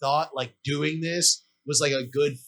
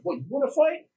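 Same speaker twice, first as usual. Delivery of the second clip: higher-pitched taunt, acted out; mock challenge; 8 semitones higher than usual. Puffer jacket guy daring the other rider, mock challenge. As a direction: imitate a man squaring up to fight. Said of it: "the two guys start arguing".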